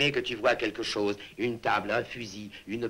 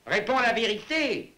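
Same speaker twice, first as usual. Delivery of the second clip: high, insistent command to answer; sharp demand; 11 semitones higher, 4 dB louder than usual. Acted. Father pressing his son for the truth, sharp demand.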